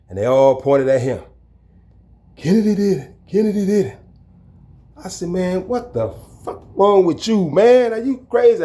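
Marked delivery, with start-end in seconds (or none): 2.4-4.0 high mocking chant of other inmates; inmates snitching. The inmates point at the culprit, high and tattling. 5.3-8.6 outraged confrontation. Retold or imitated. Walking toward the thrower, he yells in outrage.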